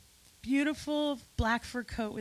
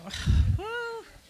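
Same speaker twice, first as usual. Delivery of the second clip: high, loud exhale; heavy sigh to gather yourself; emotional sigh; 11 semitones higher, 5 dB louder than usual. Real, not acted. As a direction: let out a big, high sigh mid-tribute. Overcome while honoring close friends.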